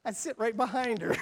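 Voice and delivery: loud, high, laughing through the words; can't stop laughing as he tells it; laughing while telling. Real, not acted. The preacher recounts following Sister Maria around, laughing loudly.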